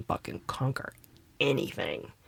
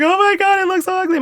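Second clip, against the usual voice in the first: much higher and louder, hammed-up distraught wail; retold wailing; 6 dB louder than usual. Recalling her first big chop at twenty-five, a mock teary high wail. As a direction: play your younger self panicking over the haircut. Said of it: "I boohoo cried".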